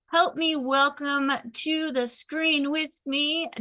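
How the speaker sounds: normal talking voice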